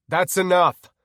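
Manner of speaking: loud, high shout; scream. Acted. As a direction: yell to stop it. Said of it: "I screamed".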